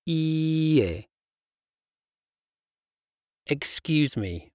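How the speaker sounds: ordinary delivery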